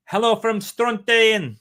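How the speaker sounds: hammed-up Scottish brogue, high and loud, slow; over-the-top Scottish accent. Reading a viewer greeting in a mock Scottish accent. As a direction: ham up a thick Scottish accent to hide bad pronunciation. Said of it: "with a ridiculous over-the-top Scottish accent".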